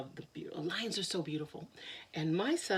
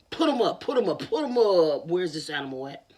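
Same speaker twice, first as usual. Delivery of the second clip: loud fighting lion; 2 semitones lower, 8 dB louder than usual. Acted.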